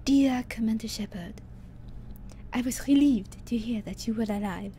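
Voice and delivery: a half-whispered, shaky imitation of the ship's doctor; Doctor Chakwas's accent. Streamer reading the doctor's letter aloud, attempting her accent. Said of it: "Doctor Michelle's accent".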